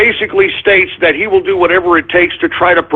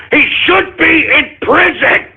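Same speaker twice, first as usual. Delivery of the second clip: higher, hammered-home verdict; pressed, raised insistence; emphatic demand; 7 semitones higher than usual. Real, not acted. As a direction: declare it like a sentence. Repeats prison demand, first take.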